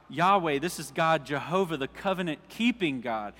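usual speaking voice

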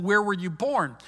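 usual speaking voice

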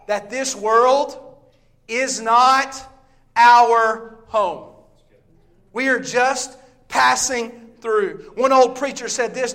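loud, high, slowed preaching with long pauses. Acted. Preacher telling church this world isn't home, slow preaching. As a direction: lean on every word, slowly. Slow climax of sermon point.

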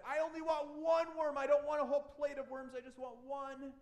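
put-on high, loud whiny kid voice; the preacher voicing Robbie's tantrum, high and whiny; whining child. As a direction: whine it like a cranky five-year-old.

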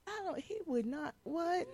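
a soft, baffled half-whisper; baffled hush. Retold or imitated. Pastor's wife mimicking her own baffled reaction, hushed. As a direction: trail off in disbelief. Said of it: "But I'm like".

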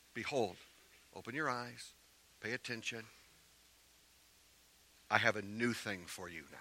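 very soft, spaced-out phrases; hushed divine address. Acted. Preacher voicing God promising a new thing, hushed. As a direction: let each phrase land quietly. Voices God's promise to the church.

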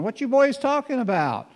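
high, light voice asking innocently; playful curious stranger. Acted. Jesus teasingly asking the road travelers, high and light. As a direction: play dumb to draw them out.

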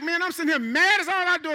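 loud, high exasperated outburst; hammed-up frustrated shout; mock outraged yell. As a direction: yell it at the TV like a fed-up fan. Retelling his angry outburst at TV.

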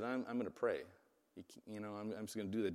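a quietly determined Daniel; quiet resolve. Retold or imitated. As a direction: say it plainly and quietly, no fuss. Daniel calmly refusing to stop praying.

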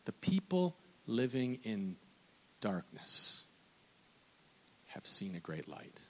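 whispered scripture, drawn low; hushed prophecy. Acted. Preacher reading Isaiah's prophecy in a hushed whisper. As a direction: breathe the prophecy like a secret.

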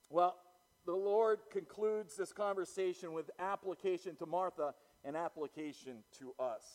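ordinary talk

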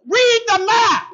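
full high shout, drawn out; loud re-enacted marital blow-up; exasperated yell. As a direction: yell it at your wife, stretching each word. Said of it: "and I yelled at her".

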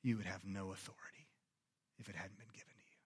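hushed, breathy whisper; Jesus whispering back to Pilate; whispered reply. Acted. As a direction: whisper it with quiet power. Jesus' quiet answer.

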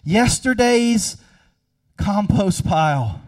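fiery sermon climax; preaching at full force. Acted. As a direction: drive it home from the pulpit.

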